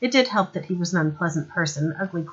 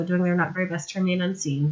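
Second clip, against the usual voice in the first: ordinary delivery, nothing marked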